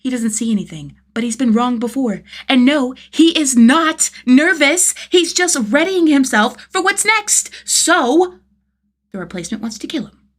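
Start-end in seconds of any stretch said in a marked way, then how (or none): 2.5-8.4 defensive, higher and louder. A narrator's reading. Defensive denial of nerves.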